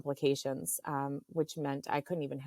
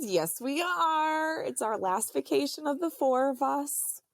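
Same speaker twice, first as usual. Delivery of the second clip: imitating insistent husband; 9 semitones higher, 4 dB louder than usual. Husband insisting to wife on last camping vacation, high mock insistence. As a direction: imitate him insisting, pitched well up.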